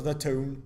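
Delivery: "tune" for town, with an oo vowel; Geordie vowel. Shown as a demonstration. Joking about Geordie speech, "tune" with oo vowel. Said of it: "They say tune".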